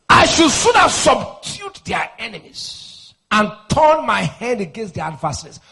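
bursts of loud, high preaching; forceful prayer declaration. Real, not acted. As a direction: proclaim victory over the adversaries, building to a shout.

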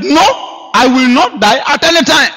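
high, firm refusal; defiant declaration. Retold or imitated. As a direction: say it with unshakable faith.

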